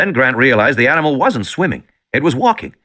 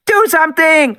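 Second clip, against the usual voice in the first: put-on much higher, louder girl's scream; high loud terrified child's scream; 4 dB louder than usual. A narrator's reading. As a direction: scream in terror at Grant. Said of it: "Alexis screamed".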